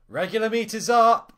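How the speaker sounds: high, loud call over kitchen noise. A narrator's reading. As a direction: shout it across the grill. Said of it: "I said loudly".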